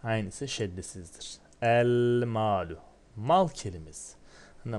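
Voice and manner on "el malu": El malu very slow and louder, with the l clearly sounded; El malu stretched out, with the lam pronounced; slow Arabic demo. Shown as a demonstration. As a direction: read El malu slowly and loudly to the class. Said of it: "Elif lam eklersen el malu olur".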